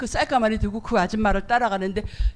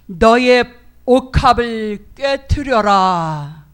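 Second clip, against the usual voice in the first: loud, slow, commanding divine voice; a loud, slow, commanding voice from heaven; booming voice of God; 8 dB louder, much slower than usual. Retold or imitated. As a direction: proclaim it like God from above. God's voice commands him.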